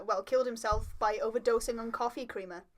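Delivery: ordinary talk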